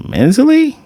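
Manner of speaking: high-pitched rising question; incredulous. Retold or imitated. Reader reacting to a greedy wish, incredulous. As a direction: lift it sharply into a baffled question.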